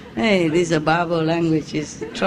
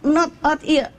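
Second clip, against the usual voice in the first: higher, mock French accent, laughing through it; 7 semitones higher than usual. Frenchman correcting the agent, higher mock French accent. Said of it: "the Frenchman said".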